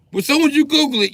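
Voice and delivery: mock excitement. Building to the punchline.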